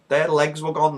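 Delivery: usual speaking voice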